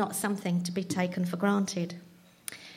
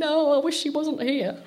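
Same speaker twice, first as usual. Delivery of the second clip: inner dismay; 5 semitones higher, 3 dB louder than usual. Retold or imitated. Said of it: "I'm sat there thinking".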